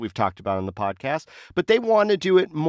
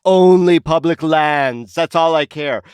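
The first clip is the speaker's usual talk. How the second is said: slow, loud mocking take on Lombardo; 3 semitones higher, 7 dB louder, slower than usual. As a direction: drag it out like a stubborn governor. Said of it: "Lombardo is like".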